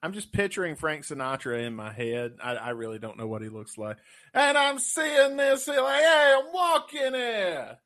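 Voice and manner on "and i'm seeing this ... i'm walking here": much higher, slow New York tough-guy voice. Retold or imitated. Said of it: "Give me your [name]".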